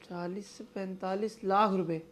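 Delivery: slow, hushed amazed echo; stunned disbelief. Acted. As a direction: repeat it softly, taking in the sum. Stunned by the savings figure. (हैरान होकर, धीमे से)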